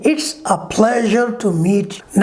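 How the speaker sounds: "It's a pleasure to meet you" very slowly, "pleasure" with a clear zh; very slow, careful model sentence; correct pronunciation. Shown as a demonstration. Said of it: "इसको कहते हैं".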